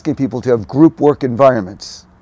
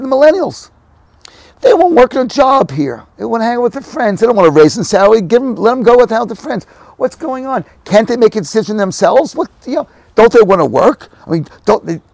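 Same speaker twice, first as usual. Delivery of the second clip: high, loud exasperated complaining voice; indignant old boomer; 9 semitones higher, 6 dB louder than usual. Retold or imitated. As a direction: play a fed-up boomer ranting about kids. Mocking baby boomer complaints.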